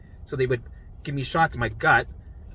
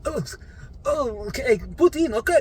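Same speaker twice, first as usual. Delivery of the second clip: pained patient; 9 semitones higher than usual. Retold or imitated. Patient retold during painful neck procedure.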